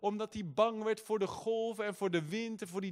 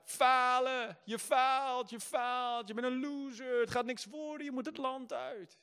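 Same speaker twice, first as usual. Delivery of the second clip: raised, accusing inner voice of failure; 7 semitones higher, slower than usual. Retold or imitated. Doubting inner voice taunts him, higher accusing tone. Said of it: "mijn hele gevoel schreeuwde het uit".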